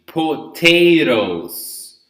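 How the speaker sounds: "Potatoes" very slow, first T crisp, middle T flapped; slow model potatoes. Shown as a demonstration. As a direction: drawl out "Potatoes" with a clear first T. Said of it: "First T is pronounced".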